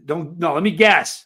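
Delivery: ordinary talk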